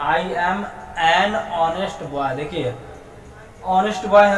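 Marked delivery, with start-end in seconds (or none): 0.1-2.3 put-on every word of I am a boy read very slowly; teacher reading the English example slowly; slow model reading. Shown as a demonstration. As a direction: read it out slowly for the class to copy. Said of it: "मैं एक ईमानदार लड़का हूँ".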